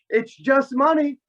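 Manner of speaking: very high, goading grandfather imitation; frantic high-pitched sarcasm, acted out; mock taunting. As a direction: rub it in, pitch pushed way up. Grandfather taunts aunt while burning bill.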